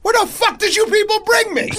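high-pitched mock outrage; indignant, accusing the whole group; angry. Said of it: "he looks at us angrily".

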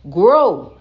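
a loud, falling call of "Grow."; shouted out. Shown as a demonstration. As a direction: shout "Grow." like a command to the world. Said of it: "and I just shouted it out".

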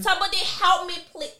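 high, loud plea for help; retold screaming. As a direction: re-enact a panicked cry for help. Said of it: "I was screaming".

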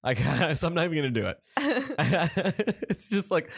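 ordinary delivery

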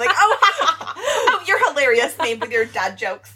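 gushing over a dad joke through laughs; sarcastic laughing praise for dad jokes; mock laughing praise. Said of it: "I'm really mad that I laughed at it".

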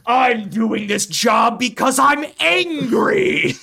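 hammed-up furious declaration; mock angry shout. Acts out working from spite.

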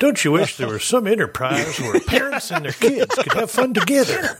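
rising eager sales pitch; salesman pitch voice. Acted.

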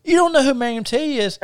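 high-pitched mock disbelief; retold astonishment in falsetto; imitating incredulous drummer. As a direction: squeak it out, incredulous.

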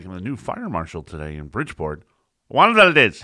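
mock radio announcer with a big Spanish flourish on the name; radio announcer imitation. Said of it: "they're like".

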